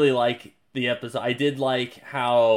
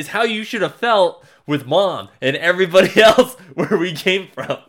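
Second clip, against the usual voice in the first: higher, louder pointed lecture; Mark scolding; 6 semitones higher, 5 dB louder than usual. Retold or imitated. Mark lecturing Omni-Man about Earth, louder higher scolding. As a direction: throw it back in his face. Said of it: "He's like".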